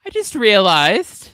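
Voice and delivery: slow, high, drawn-out amazement; hammed-up dawning realization, loud and high; mock astonishment. Mimicking a friend who finally notices an accent, mock amazed.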